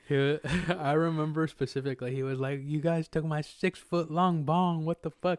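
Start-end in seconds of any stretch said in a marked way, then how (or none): 2.6-5.4 higher, slow, indignant complaint; mock outrage. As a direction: imitate him whining about his bong. Imitating the robbed man.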